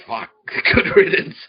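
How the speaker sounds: laughing out a loud, high, slow cheer; laughing mock cheer. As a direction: laugh it out like a relieved crowd. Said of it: "they'll say".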